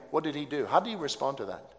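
ordinary talk, nothing marked

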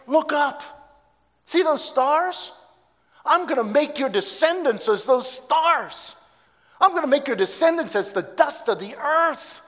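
booming, high-pitched divine proclamation; voice of God. Acted. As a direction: proclaim it like God promising the stars. God speaks to Abraham in story.

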